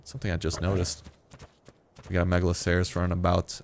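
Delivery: usual speaking voice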